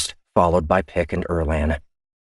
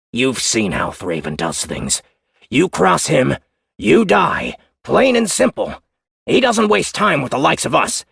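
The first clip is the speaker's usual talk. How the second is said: higher, louder hostile reply; defiant crewman; 8 semitones higher, 4 dB louder than usual. Acted. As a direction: challenge her openly. Otero voice.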